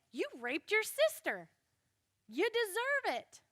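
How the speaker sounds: high-pitched, emphatic acted accusation; mock righteous accusation. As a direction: throw the blame with indignant relish. Voicing righteous avenger to the culprit.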